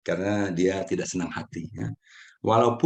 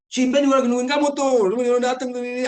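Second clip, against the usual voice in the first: much higher and louder, nagging and reproachful; pushy, accusing tone; 14 semitones higher, 7 dB louder than usual. Shown as a demonstration. To friend A about B waiting, pushy accusing tone. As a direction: say it like you're blaming them for keeping B waiting. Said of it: "kalau ada dua intonasi yang berbeda".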